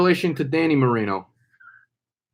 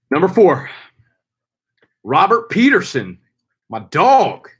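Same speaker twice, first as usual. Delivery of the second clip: hyped announcer call; 4 semitones higher, 5 dB louder than usual. Acted.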